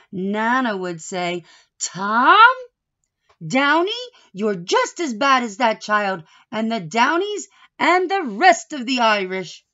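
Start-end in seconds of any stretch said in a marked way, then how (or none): 1.8-9.7 loud, high mock scolding of grandpa; playful telling-off from grandma. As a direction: wag your finger and scold him loudly. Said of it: "He's being scolded".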